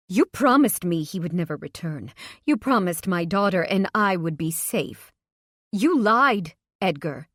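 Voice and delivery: loud, rising to a furious peak; angry accusation. Acted. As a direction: hurl the betrayal at him. Mother accusing Edgar of lying.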